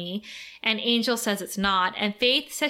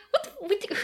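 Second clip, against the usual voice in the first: high-pitched shocked what the; shocked disbelief; 10 semitones higher than usual. Retold or imitated. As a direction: squeal it out in disbelief. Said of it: "And I was like".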